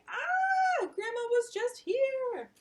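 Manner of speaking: high loud exclamation; mom's high, loud cry of disbelief; exclaiming mom. Acted. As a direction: call it out in high dismay. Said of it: "her mom's saying".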